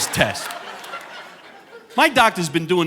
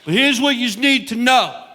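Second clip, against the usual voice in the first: comedian as captain; 8 semitones higher than usual. Pretend. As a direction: announce it like a tipsy captain on the PA. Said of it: "if I came over the loudspeaker".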